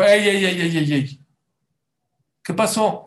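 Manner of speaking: God calling Abraham to stop, loud and high; scolding call. Acted. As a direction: call out sharply to halt him.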